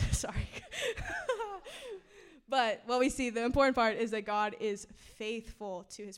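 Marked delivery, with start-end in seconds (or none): 0.5-2.3 high breathy giggle breaking out; embarrassed laugh after losing the thread; real laugh. Real, not acted. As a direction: let a giggle burst out mid-talk. Laughs at her own slip.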